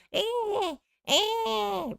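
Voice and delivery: high mock sob, a hammed-up little cry; imitating Daryl crying to his mother, mock whimper. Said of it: "That was you crying".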